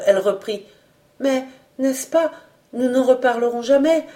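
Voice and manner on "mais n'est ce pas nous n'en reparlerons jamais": higher, plaintive plea; suddenly pained. A narrator's reading. Young woman asking him never to speak of it again, pained. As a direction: plead with a touch of hurt, pitched up. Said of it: "d'une voix subitement douloureuse".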